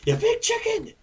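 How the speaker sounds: very high mock scream; hammed-up high-pitched taunt; screaming Superman. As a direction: squeal it like a screaming insult, played for laughs. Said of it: "Superman's just screaming at him".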